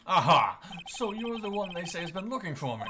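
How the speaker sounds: put-on theatrical old gentleman; pompous old lawyer. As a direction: boom it out like a grand old attorney. Streamer voices old lawyer.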